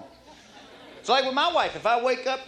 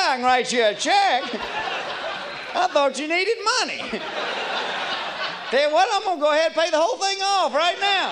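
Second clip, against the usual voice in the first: laughing; 6 semitones higher than usual. Retold or imitated. Story dealer laughing, pays it off.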